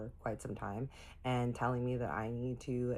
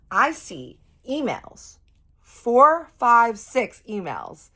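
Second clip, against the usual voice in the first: slow, loud, higher, every word stretched out; dramatic slow build; 9 semitones higher, 12 dB louder, much slower than usual. A narrator's reading. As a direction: drag it out like a big reveal. Building to shock in the story.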